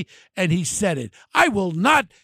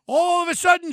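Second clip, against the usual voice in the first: normal talking voice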